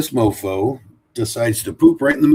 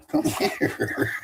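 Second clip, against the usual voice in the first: high laughing come here, breaking into a laugh; much higher call, laughing at the dog. Real, not acted. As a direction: coax the dog through a laugh.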